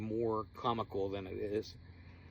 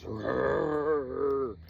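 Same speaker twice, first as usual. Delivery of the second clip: loud rumbling growl imitation; mock bigfoot growl; 2 semitones higher, 7 dB louder than usual. Narrator imitating friend's Bigfoot call, loud mock growl. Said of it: "he does".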